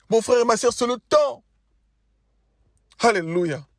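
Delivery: preacher urging the congregation to cry out, high and fervent; rising fervent preaching. Acted. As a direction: climb into the call, urging the church to cry out. Preacher builds to high pitch.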